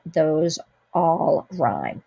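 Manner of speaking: usual speaking voice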